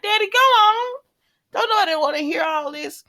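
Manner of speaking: a grown daughter waving her dad away; exasperated daughter. Retold or imitated. Brooklyn shooing her father off.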